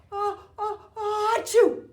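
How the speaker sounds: building ah-ah-achoo sneeze; big sneeze. Acted. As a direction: wind up then sneeze loudly. Bear sneezes in story.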